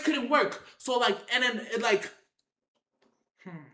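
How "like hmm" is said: normal talking voice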